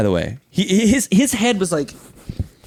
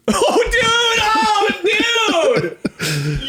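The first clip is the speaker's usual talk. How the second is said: much higher mocking dude voice, laughing through it; dumb dude voice; much slower than usual. The narrator mocks the driver in a much higher, laughing dude voice. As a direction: ham up a clueless guy's whine, giggling. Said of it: "He goes".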